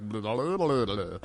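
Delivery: low blob babble. Retold or imitated. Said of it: "that's a blob voice".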